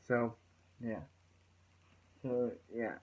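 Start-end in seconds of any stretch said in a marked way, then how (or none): none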